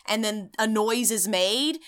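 ordinary delivery

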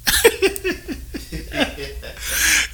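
airy high-pitched laugh; breathy high laugh. Real, not acted. Laughing at hottest day claim.

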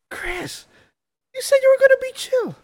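high-pitched imitation of a bickering pair; mock exasperated quote. Retelling Adebayo confronting a teammate, in mock high-pitched protest. Acting out a scene's argument.

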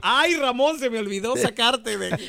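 high-pitched lament in a woman's role; Chayo. Acted. Chayo remembering Ramón is still buried, high dismayed cry. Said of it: "dijo la Chayo".

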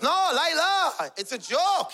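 put-on high, drawn-out protest with accent; shaken pleading. Father backpedalling to his angry wife, shaken pleading. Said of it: "He got shook. It shook him".

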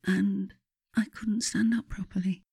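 high, breathless whisper; whispered helplessness. A narrator's reading. Narrator whispering as she fails to stand. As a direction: whisper it, near tears. Stiff cold body, sob follows.

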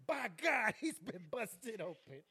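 hammed-up wrestling commentator outburst; Jim Ross. Wrestling announcer reacts to a brawl, mock outrage. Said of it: "Jim Ross".

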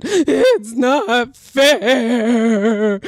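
hugely drawn-out mock wail; hammed-up drooling cry of a six-year-old; imitated crying child. Shown as a demonstration. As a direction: wail it very slowly like a child mid-tantrum. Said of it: "Let me see if I can do it".

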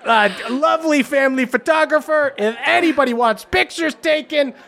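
high, effusive saleswoman covering for a friend; Stella, gushing and quick-witted. Acted. Stella rescuing Zerk as family photographer, high and gushing. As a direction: drum up a crowd in a high, excited voice. Game master plays Stella.

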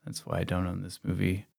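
softly deflated second thought; quiet realization. Retold or imitated. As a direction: let the excitement drain into a soft aside. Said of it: "you're like".

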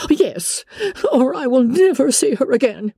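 high, fretful mother's voice; an anxious wife pleading her case, pitched high; Mrs Bennet. Acted. Wife explains the quarrel to husband, high and fretful.

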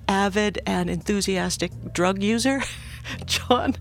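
usual speaking voice

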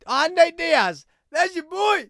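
sudden loud, high outburst; panicked shout. Real, not acted. Spotting a fighter on screen, panicked excited shout. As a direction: blurt it out at full volume. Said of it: "I panicked".

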